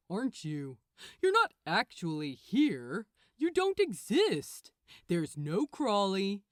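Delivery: a hint of a patronising archangel; condescending Gabriel. Gabriel explains to Crowley he doesn't exist, mildly condescending.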